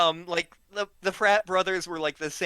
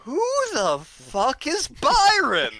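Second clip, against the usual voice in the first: much higher, slow, dazed and confused; baffled drunk friend; 11 semitones higher, 5 dB louder, slower than usual. Retold or imitated. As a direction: drag it out like a lost drunk who forgot everything. Drunk friend's baffled question retold.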